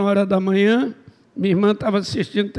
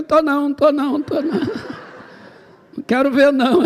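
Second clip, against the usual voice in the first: higher-pitched repeated denial; comic refusal; 5 semitones higher than usual. Retold or imitated. Man refusing to look at the girl for pastor, comic refusal. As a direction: refuse to look, playing it for laughs.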